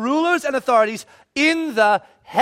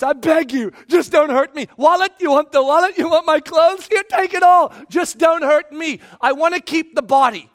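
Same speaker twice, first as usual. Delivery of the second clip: hammed-up frantic plea, higher and louder; begging on knees; 5 semitones higher, 4 dB louder than usual. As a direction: beg the robber, building to a shout. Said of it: "We fall on our knees".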